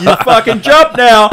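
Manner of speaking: high, loud mock command; hammed-up threatening yell; shouted order. Ship aims cannons at the kid, shouted comic order.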